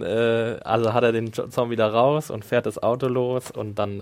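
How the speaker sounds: ordinary talk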